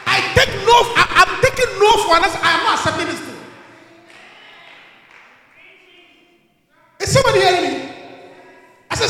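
loud, defiant declaration; full-force preaching. Acted. Preacher refusing to take no for an answer, full-force preaching. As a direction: preach it with hard, repeated force.

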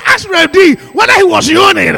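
preacher shouting the repeat to the congregation. Shown as a demonstration. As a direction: call it out again so the back rows hear. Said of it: "I said".

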